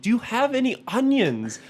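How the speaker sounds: high-pitched attempt at a Scottish accent. Ordering at Burger King in character, hammed-up Scottish accent. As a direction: put on your best Scottish, voice pushed up high. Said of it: "I tried my best Scottish accent".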